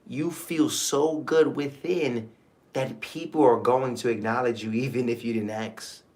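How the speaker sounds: hushed, soothing inner-peace voice; calm affirmation, low and quiet; soft affirmation. Acted.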